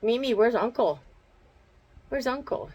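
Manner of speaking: bright dog-directed call; pet-talk call. Real, not acted. Calling to the dog.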